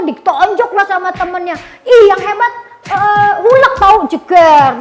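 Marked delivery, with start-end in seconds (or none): 1.8-4.8 loud, high kiddie retort; arguing little friend. Retold or imitated. Friend insists Hulk wins and punches, loud childish retort. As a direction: shout it like a kid ending in a punch.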